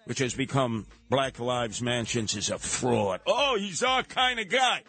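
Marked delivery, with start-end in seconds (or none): 3.2-4.8 high, gushing mock approval; mock admiring listener. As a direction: coo it like a smitten fan. Mocking Fox listeners.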